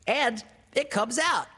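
very high squeaky exclamation; high little piglet voice; excited piglet. A narrator's reading. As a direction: exclaim as a tiny excited animal. Piglet marvels at the balloon.

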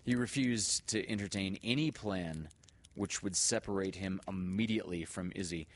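plain reading voice

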